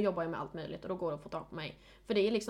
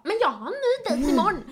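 high, louder chirpy friend; 9 semitones higher, 8 dB louder than usual. Retold or imitated. Friend voiced bouncing back.

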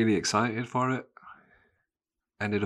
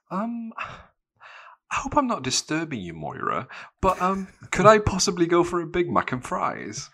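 put-on high, timid, polite kid voice; overly polite request; 10 semitones higher, 4 dB louder than usual. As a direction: ask very high and apologetically. Timid kid asks mum for order.

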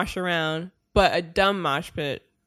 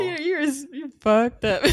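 laughing; 7 semitones higher than usual. Real, not acted. Laughing at Peter's slip.

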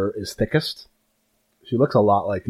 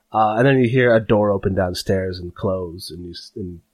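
ordinary talk, nothing marked

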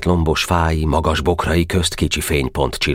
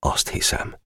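very soft whispered reply; grim quiet admission; breathed answer; hushed; 7 dB quieter than usual. Acted.

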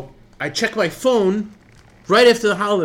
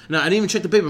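usual speaking voice

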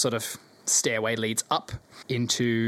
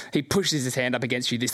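ordinary delivery

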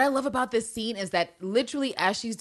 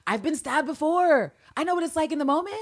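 breezy explanation; 9 semitones higher than usual. Retold or imitated. Acting out her breezy explanation.

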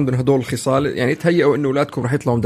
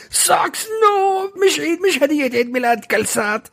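much higher, mock-crying protest; whiny crying kid; 13 semitones higher, slower than usual. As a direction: wail like a disappointed child. Kids whining about socks gift.

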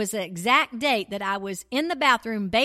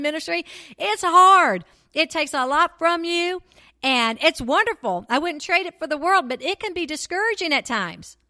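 louder, higher, impassioned preaching; fervent preaching; 5 semitones higher, 6 dB louder than usual. Real, not acted. Preacher to women in ministry, fired up and loud. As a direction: press it home to the women in ministry.

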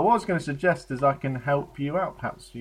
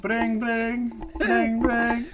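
high sing-song mock ringtone; imitated phone ringing; 7 semitones higher, much slower than usual. Role-play caller making the phone ring, high imitation. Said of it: "That's a phone ringing".

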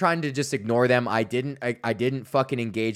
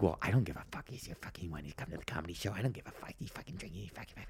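much softer, lower mumbled inner voice; voicing her inner thoughts; 6 semitones lower, 15 dB quieter, much faster than usual. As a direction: mutter her private grumbling. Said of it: "commentating on the mic of, like, their internal dialogue".

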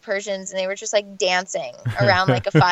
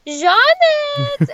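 high, loud call across the gym; calling out a name; 7 dB louder than usual. Retold or imitated. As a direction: sing out the name like spotting a friend. Mom's friend calls across the gym.

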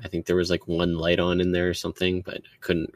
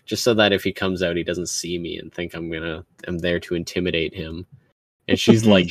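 ordinary delivery

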